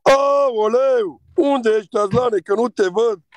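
high, loud exclamation shouted across the room; loud mock call-out. Real, not acted. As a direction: yell out for him like he vanished. Loud mock call during live battle.

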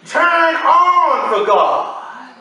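slow, high, shouted call; preaching shout. Real, not acted. Preacher calling the church to turn on for God with a shout. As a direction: stretch it out and call them to God.

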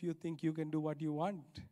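normal talking voice

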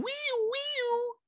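a sudden falsetto squeal on wee; squeaky high wee. Shown as a demonstration. Host trying to launch listeners into the episode, falsetto squeal. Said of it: "Maybe I just do like a".

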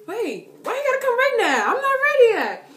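high, loud playful protest; hammed-up flustered wail; mock panic. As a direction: yell it high, as if caught unprepared. Acts panic at crush arriving now.

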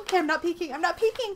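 high, loud, giddy; giddy excitement resisting a peek; excited. Real, not acted. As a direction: squeal it out, trying not to look. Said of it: "That's how excited I am".

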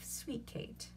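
hushed, conspiratorial; whispered aside. A narrator's reading. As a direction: whisper it to her. Petruchio aside to wife.

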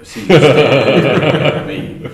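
breaking into a loud, high laugh; amused, laughing out loud at being singled out. Real, not acted. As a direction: say it through real laughter. Put on the spot, group laughs.

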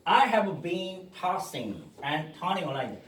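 I have been tossing and turning a line을 천천히 이어서; 느리게 연음 시범; slow linked demo. Shown as a demonstration. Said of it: "요렇게까지 발음하는 거". (느린 연음 시범)

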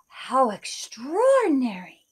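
breathless whispered wonder; awed whisper. Acted. Kate sees the shining beans, awed whisper. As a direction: whisper it as if dazzled.

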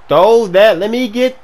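loud, rising high call; urgent yelling. Real, not acted. Receiver breaking open for a pass, urgent yelling. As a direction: holler for the ball.

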